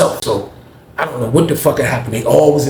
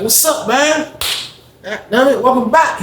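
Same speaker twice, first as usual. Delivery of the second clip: excited greeting; 11 semitones higher, slower than usual. Retold or imitated. Greeting Neil back, excited.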